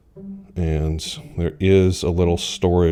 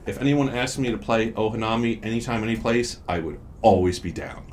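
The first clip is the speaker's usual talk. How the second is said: upbeat, high sales pitch voice; ad read; 6 semitones higher, 3 dB quieter than usual. Acted. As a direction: pitch it like a commercial.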